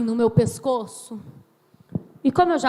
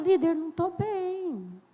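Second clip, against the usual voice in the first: soft, slow, higher plaintive call; mock plea for help; 4 semitones higher, 7 dB quieter than usual. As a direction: act out a small pleading phone call. Voices member calling leader for help.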